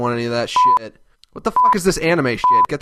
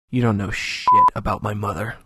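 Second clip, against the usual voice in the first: lower, cold threat; low menace; 4 semitones lower than usual. Acted. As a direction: warn them low and cold. Low menacing reply about mother.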